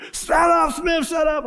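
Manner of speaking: high, loud shut up; mock angry shout. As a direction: yell it at the singing soldier. Sergeant shouts at singer.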